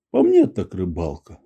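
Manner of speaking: low, understated grumble; gruff grumble. A narrator's reading. Maksimov answering the old man while tossing fish, grumbling. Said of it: "буркнул Максимов".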